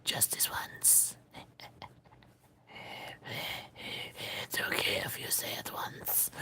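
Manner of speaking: breathy, pleading whisper; sneaky little coaxing whisper. Real, not acted. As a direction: egg him on under your breath. Coaxing someone to say it.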